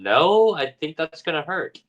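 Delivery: high, pleading "no"; scared refusal. Retold or imitated. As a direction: protest in mild fright. Betty refusing the needle.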